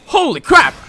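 high, loud startled yell; startled outburst. Real, not acted. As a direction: blurt it out in shock. Startled by something in game.

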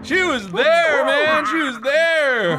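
high, loud, drawn-out yell; alarmed shouting. Real, not acted. Telling teammate the demon was there, alarmed shouting. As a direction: holler it in shock, stretching the words.

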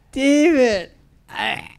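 very high, drawn-out comic call; Farley. Retold or imitated. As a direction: imitate a goofy friend calling out high. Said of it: "he goes".

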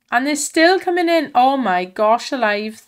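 real thrilled exclamation; excited disbelief. Real, not acted. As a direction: call it out amazed at the run of luck. Multipliers keep coming in.

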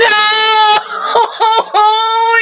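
high sobbing wail at the song; a real cry breaking out high; real emotional wail. Real, not acted. Crying out at song start.

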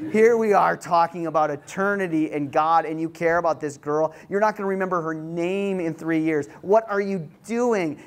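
mock-scolding tirade in sheer disbelief; exasperated rant. Youth worker inwardly scolding the lovesick boy, exasperated.

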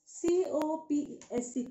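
copse spelled letter by letter as C O P S E; teacher spelling copse for the class. Shown as a demonstration. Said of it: "spelling out copse".

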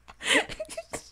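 shrill giggle, very high; high squealing laugh. Real, not acted. Streamer laughing at herself for chat, high squeal.

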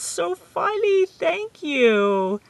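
high laughing squeal; excited squeal. Real, not acted. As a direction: squeal it, tickled. Squealing at coincidence.

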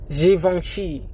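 Givenchy said the French way, zhee-von-SHEE; correct pronunciation. Shown as a demonstration. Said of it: "It's pronounced".

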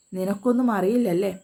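ordinary delivery, nothing marked

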